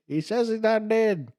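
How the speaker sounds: high, slow put-on voice; Monty Python voice passing it on; mock medieval voice. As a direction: relay the claim in a high silly voice. Quoting the sketch.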